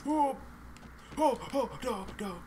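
high-pitched startled yelps; alarmed. Real, not acted. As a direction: yelp high, then back off. Alarmed while sneaking into house.

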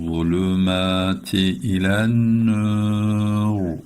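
slow, melodic chanted recitation; Quran recitation. Shown as a demonstration. Teacher chanting the verse for students, slow and melodic. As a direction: draw each word out in tajweed chant.

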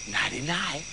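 low, very soft taunt; hushed, sinister farmer; menacing goodnight. Acted. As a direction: softly taunt the victim.

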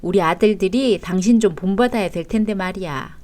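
normal talking voice

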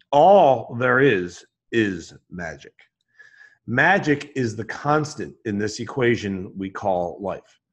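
slow, emphatic recitation, key words hit hard; measured pronouncement with weighty pauses; solemn declaration. Acted. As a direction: declare it like a creed, spaced out. Recites a personal maxim.

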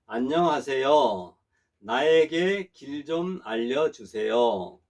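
slow, clear phrases for students to repeat; slow model sentence. Shown as a demonstration. Teacher models a sentence for the class to repeat, slowly. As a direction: spell it out slowly so the class can follow. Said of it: "자, 한번 따라 해보겠습니다".

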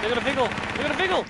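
loud, high, urgent callout; excited shout. Real, not acted. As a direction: yell it out to the team. Enemy spotted in game.

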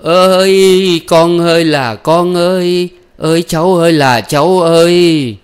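high, loud, long-drawn lament; wailing cry. A narrator's reading. Grandmother crying out for child and grandchildren, wailing loudly. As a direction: howl it out, stretching each call. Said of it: "cụ gào lên".

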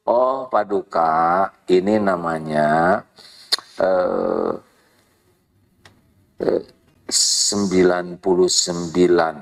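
bow and counsel the king gently; humble advisor. Acted. Advisor calmly explaining to the king, low and deferential.

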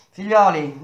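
loud call to the men. A narrator's reading. Metello calls out to his workmates. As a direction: raise the voice to gather them.

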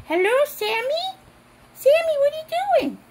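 high, eager calling voice; pretend phone chat. Calling Sammy on pretend phone, high-pitched chatter. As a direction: pitch it up, rising into the question.

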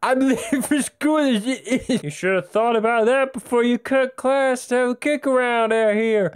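high, silly character voice; put-on schoolboy dialogue voice. Schoolkids arguing over a kickabout, high put-on voice. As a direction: pitch it up like a hammed-up game character.

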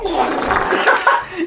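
high, breath-heavy laugh; burst of laughter. Real, not acted. Player laughing at a dud pack, high laugh. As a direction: laugh at your own bad luck.

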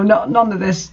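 ordinary talk

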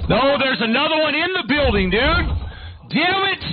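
high, exasperated outcry; frustrated yell. Real, not acted. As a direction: cry out in frustration as the enemy appears. Enemy in building, frustration.

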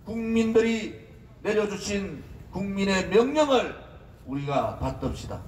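ordinary delivery

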